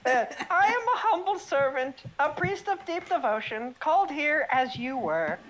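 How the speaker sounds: priest voice. Acted. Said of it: "Say it again".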